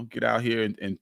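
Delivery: usual speaking voice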